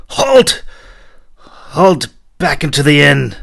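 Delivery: very high, loud halt command; shouted order. A narrator's reading. Knight shouting to halt the troops, very loud. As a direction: call the column to a stop.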